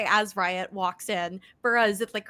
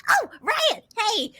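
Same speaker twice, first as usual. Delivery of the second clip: much higher, louder bright call; cheery Volus greeting; 14 semitones higher, 8 dB louder, much slower than usual. Acted. Doctor Burra greets Riot walking in, high and cheerful. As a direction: sing out a delighted hello. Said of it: "before she turns to the door and".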